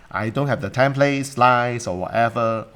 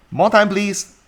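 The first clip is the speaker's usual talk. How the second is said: pleading excuse; 10 semitones higher, 6 dB louder, slower than usual. Retold or imitated. Voicing an excuse.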